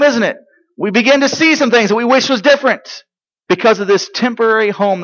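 high, urgent pulpit voice; forceful preaching. Real, not acted. Preacher telling the congregation this life is passing, high and insistent. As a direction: press it on the congregation.

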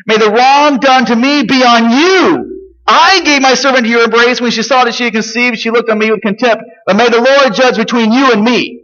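aggrieved Sarah. Acted. Sarah blaming her husband Abraham, high and aggrieved. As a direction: let Sarah's grievance ring out.

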